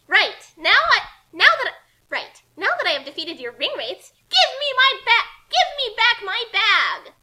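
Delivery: demanding. Acted. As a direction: shout it down at him and insist. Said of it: "Kasumi demanded".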